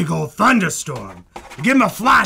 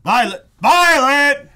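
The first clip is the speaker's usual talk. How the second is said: louder second call across the studio; 8 semitones higher, 5 dB louder than usual. Real, not acted. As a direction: shout her name to fetch her. Calling out across the room.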